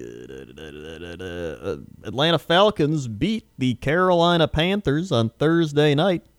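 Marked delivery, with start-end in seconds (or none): 0.0-1.8 quiet mumble. Real, not acted. Filler while searching notes.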